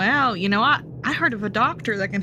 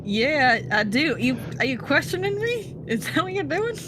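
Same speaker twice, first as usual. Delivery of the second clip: offended; 7 semitones higher than usual. Acted. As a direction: pitch rising, affronted challenge. Offended passenger confronts S0.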